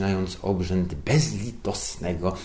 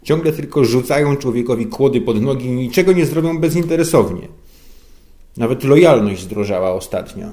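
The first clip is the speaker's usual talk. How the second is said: complaining boss; 4 semitones higher, 6 dB louder than usual. A narrator's reading. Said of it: "pożalił się Żbik".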